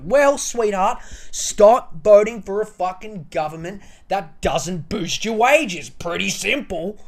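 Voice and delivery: a lecturing loud rant; condescending scold. Real, not acted. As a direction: spell it out with contempt. Scolding the complainer.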